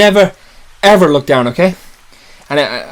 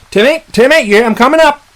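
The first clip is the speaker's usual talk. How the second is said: raised, higher calling voice; 8 semitones higher, 4 dB louder than usual. Acted. Man telling Timmy he's coming up the tree, calling out. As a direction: call up to someone overhead.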